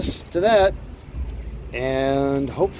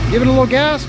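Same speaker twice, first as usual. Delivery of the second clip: raised voice, coaching over the noise; calling out over engine; 6 semitones higher, 4 dB louder, faster than usual. Real, not acted. Coaching son starting the engine.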